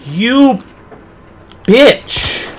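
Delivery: high, loud cry of outrage; shouted curse. Real, not acted. Player curses after a game scare, shouted. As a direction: yell it at what just scared you.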